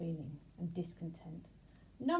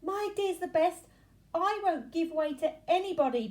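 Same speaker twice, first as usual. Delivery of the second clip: loud, higher stubborn shout; 10 semitones higher, 11 dB louder than usual. A narrator's reading. Bees all shouting each other down, loud. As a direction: shout it out, refusing to yield. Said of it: "they all shouted".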